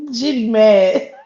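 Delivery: loud, drawn-out laughing tease. Real, not acted. Vlogger gloating to viewers over the drama, laughing.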